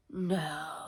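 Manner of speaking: hushed, low, stunned refusal; stunned disbelief. Retold or imitated. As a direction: say it softly, as if stunned. Retold stunned disbelief at the news.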